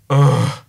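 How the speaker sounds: loud, high mock groan; imitated groan. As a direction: groan like a reluctant boss. Boss's reaction, imitated.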